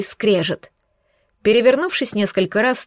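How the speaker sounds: plain reading voice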